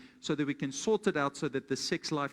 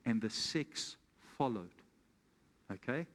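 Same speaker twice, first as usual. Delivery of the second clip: ordinary talk, nothing marked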